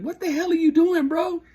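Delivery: high, loud boss yelling across the kitchen; yelling supervisor. Retold or imitated. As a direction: catch him red-handed and shout it high. Supervisor catches him squeezing food.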